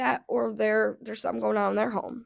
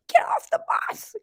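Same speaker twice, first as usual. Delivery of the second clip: high squeaky mock bus driver, half-whispered. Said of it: "the bus driver's like".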